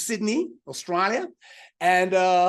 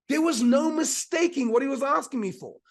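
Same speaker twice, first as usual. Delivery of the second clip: normal talking voice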